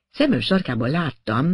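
ordinary delivery

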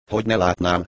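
ordinary delivery, nothing marked

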